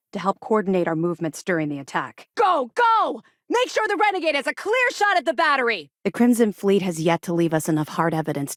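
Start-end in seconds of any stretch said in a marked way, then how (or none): 2.4-5.9 high, urgent commands shouted over combat; urgent battle orders. Acted. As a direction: drive the squad forward, yelling. Combat orders during attack.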